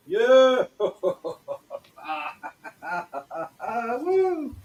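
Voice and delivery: high loud whoops, laughter; excited celebration. Shown as a demonstration. Coach switches back to celebrating, exuberantly excited. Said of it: "then we'll switch back again".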